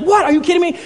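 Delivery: high-pitched indignant disbelief; Daniel. Retold or imitated. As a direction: act out an exasperated student. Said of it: "he just unloads on Mister Miyagi".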